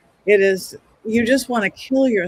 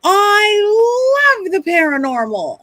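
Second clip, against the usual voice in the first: high, slow, gushing client voice; 12 semitones higher, 4 dB louder, much slower than usual. Retold or imitated. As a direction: draw it out in a thrilled high voice. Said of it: "And then she goes".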